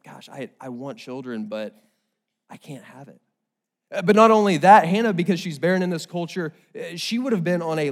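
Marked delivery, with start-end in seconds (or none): 0.0-3.2 hushed, sinking confession; quiet pain. Acted. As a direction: softly voice a woman's private sorrow, trailing very low. Voicing a childless woman's pain.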